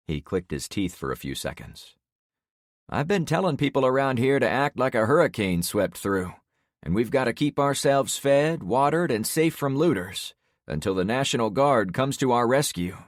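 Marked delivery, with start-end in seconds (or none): 2.9-13.0 higher, louder neighbor; lightly voiced neighbor; neighbor man voice.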